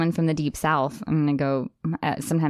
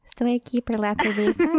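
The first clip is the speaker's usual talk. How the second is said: Minnesotan Spanish; 3 semitones higher than usual. Shown as a demonstration. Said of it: "Minnesotan accent with the Spanish".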